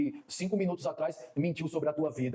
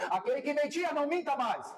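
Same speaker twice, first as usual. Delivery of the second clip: climactic exhortation; 10 semitones higher than usual. Real, not acted. Preacher charging liars to lie no more, climactic exhortation. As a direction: pitch leaps up for the climax. Sermon list reaches its climax.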